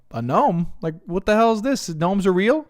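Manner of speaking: high, loud incredulous questions; astonished disbelief. Retold or imitated. Retelling her reply to Paula about gnomes, loud high astonishment. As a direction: replay the shock of learning gnomes exist.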